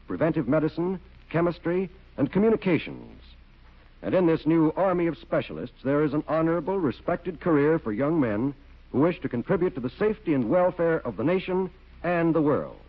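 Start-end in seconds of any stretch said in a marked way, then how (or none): none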